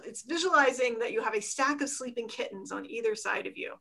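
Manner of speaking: normal talking voice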